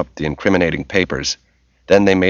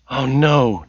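higher, mild moan of dismay; 6 semitones higher than usual. A narrator's reading. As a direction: let it slip out as a light moan. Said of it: "Tracy moaned".

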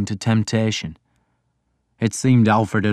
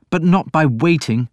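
higher, louder hard edge; sudden hardness; 8 semitones higher, 4 dB louder than usual. A narrator's reading. Alfred to the priest, suddenly hard. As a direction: harden the voice suddenly. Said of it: "a sudden hardness in his voice".

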